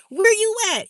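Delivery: high, loud walkie-talkie call; mock two-way radio shout; chirp phone call. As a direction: holler it like a Boost mobile chirp. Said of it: "Remember them chirp phones?".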